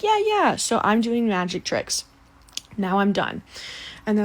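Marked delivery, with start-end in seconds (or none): 0.0-0.6 high-pitched imitation of excited children; eager kids. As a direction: squeal it like keen little kids. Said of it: "the kids were like".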